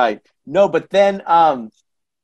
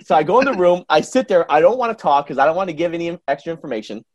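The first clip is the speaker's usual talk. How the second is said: normal talking voice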